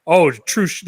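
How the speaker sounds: ordinary delivery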